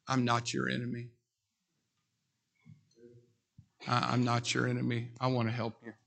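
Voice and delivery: fading gently to almost nothing; tender appeal. Real, not acted. Preacher assuring listeners he is not their enemy, soft and fading.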